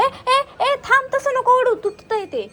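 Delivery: high, loud, sharp cry to stop; urgent warning. Real, not acted. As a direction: call out to halt someone right now. Urgently stopping partner pulling at plant.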